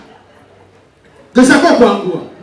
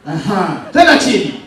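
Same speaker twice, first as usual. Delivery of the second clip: ordinary delivery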